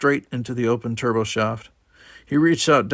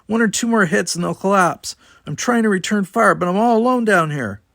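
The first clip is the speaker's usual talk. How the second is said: put-on higher, louder, tense young woman's voice; urgent distress report; 9 semitones higher, 6 dB louder than usual. A narrator's reading. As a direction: report the failing shields in alarm. Tanya reporting under attack.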